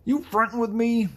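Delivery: high, loud put-on challenge; game tough guy. Arcade guy picking a fight, high loud put-on challenge. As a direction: pick a fight in a high cartoon voice. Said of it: "trying to pick a fight with you".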